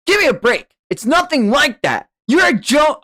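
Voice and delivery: high, loud protesting yell; indignant outburst. Acted. Hothead denies the accusation, indignant high yell. Acted quarrel in story.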